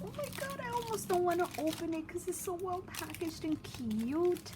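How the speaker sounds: very high, fast gushing delight; gushing excitement. Real, not acted. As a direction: gush over how cute it is. Overjoyed at the packaging.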